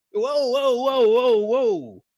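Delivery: high stunned whoa whoa; high startled protest. Real, not acted. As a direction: pull back in shock, voice up. Reacting to the confession.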